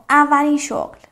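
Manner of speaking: normal talking voice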